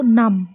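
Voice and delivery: नम said firmly and loud; a clear model word नम; emphatic answer. Shown as a demonstration. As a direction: announce नम clearly to the class. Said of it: "क्या पढ़ेंगे हम इसको".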